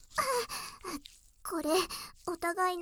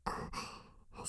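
normal talking voice

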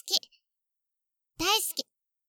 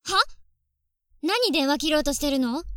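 sharp accusation; 5 dB louder than usual. Acted. Sister catches brother hanging up.

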